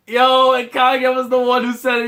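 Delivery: high, loud fan outburst; excited shout. Real, not acted. Excited reaction to Kageyama's line.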